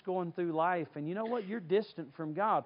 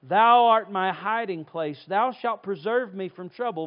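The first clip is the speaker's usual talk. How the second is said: scripture reading; 8 dB louder than usual. Acted. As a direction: read it like holy writ.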